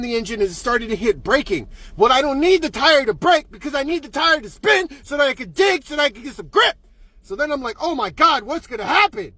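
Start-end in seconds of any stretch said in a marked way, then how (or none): 2.0-6.7 worked-up exasperation. Retold or imitated. Driver fed up with traction control, worked-up exasperation. Exasperated complaint about the car. 7.8-9.3 higher, mildly panicked storytelling voice; mock panic. Driver starting to skid, mock panic. Retold panic while skidding.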